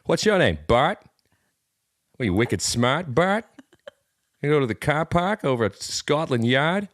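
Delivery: put-on thick Boston accent, wicked smart. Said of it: "These are all things to say in a Boston accent".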